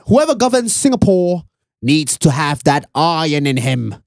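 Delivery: British-inflected Singaporean statesman; Lee Kuan Yew's accent. Acted. Founding father on who governs Singapore, British-tinged accent. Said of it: "That sounds quite British".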